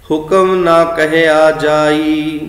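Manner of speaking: slow, sustained verse reading; scripture recitation. Shown as a demonstration. Teacher shows how the line gets read, slow recitation. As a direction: recite with held vowels. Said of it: "ਅਸੀਂ ਆਹ ਵਾਲਾ ਉਚਾਰਨ ਕਰਦੇ ਹਾਂ".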